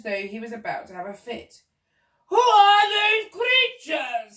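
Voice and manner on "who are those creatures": high, slow, loud outrage; bellowing. A narrator's reading. Duke sees giraffe and monkey, bellowing. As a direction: bellow it with every word stretched. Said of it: "he bellowed".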